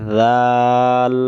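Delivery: teacher models জাল; letter demo. Shown as a demonstration. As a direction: drag জাল out slowly. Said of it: "তাহলে তাড়াতাড়ি".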